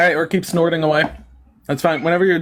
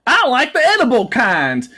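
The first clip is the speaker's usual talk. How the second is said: high hillbilly mimicry; exaggerated dopey Southern impression; mocking dumb guest; 9 semitones higher, 3 dB louder, slower than usual. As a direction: imitate a dim country yokel's silly answer. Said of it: "And you being like".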